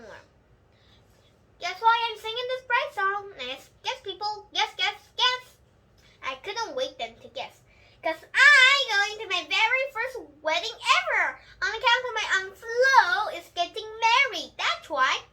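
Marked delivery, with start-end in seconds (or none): 1.6-5.5 high, loud eager chant; bursting to tell the news; excited singsong. A narrator's reading. Junie B. chanting for her friends to guess, excited. 8.0-15.2 high, loud and bursting; thrilled announcement. A narrator's reading. Junie B. telling friends about the wedding, thrilled.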